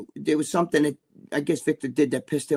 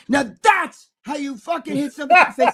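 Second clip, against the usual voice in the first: hyped boast; 9 semitones higher, 6 dB louder than usual. Retold or imitated. Retold gym boast.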